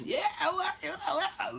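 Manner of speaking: high-pitched mock drunken babble; going crazy. Father and grandfather babbling drunk, going crazy. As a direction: imitate gleeful nonsense chatter way up high. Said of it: "they're just going crazy".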